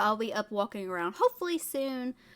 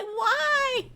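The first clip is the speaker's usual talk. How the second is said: incredulous why; 7 dB louder than usual. Retold or imitated. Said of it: "he's like".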